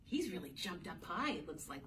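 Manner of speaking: plain reading voice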